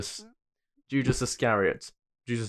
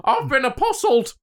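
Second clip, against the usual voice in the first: high mock Paul voice; a playful put-on voice for Paul; lightly voiced Paul; 10 semitones higher than usual. Joking about Paul, in a light mock voice.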